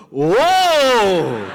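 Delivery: loud, delighted whoa with a laugh; amazed whoa. Retold or imitated. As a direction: gasp in wonder as the foam rises. Foam rises, ladies amazed.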